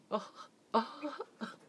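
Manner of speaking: high, slow, strained mock laugh; imitating bot laugh. Streamer failing to copy the bot's laugh, strained high mock laugh. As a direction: reach for a pitch you can't quite hit. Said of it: "出ない。そのキーが出ない".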